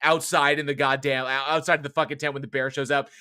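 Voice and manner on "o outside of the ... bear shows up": ordinary talk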